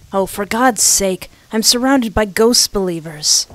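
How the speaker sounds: ordinary delivery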